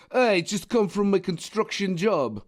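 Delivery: badly faked Eastern European construction worker; botched Russian accent. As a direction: do a terrible Russian accent, drawn out. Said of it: "I don't know why you're Russian".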